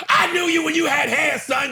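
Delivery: high, loud shouted insult; roast shout. Retold or imitated. Donnell roasting Jeffrey Ross, loud shouted jab. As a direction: yell the burn across the stage. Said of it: "Donnell Rollins turns to Jeffrey Ross and goes".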